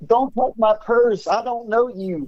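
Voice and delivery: high, slow comic voice guarding a purse; put-on character voice. Put-on voice mid-banter.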